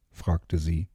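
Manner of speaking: usual speaking voice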